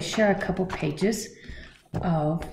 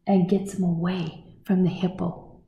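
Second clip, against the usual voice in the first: plain reading voice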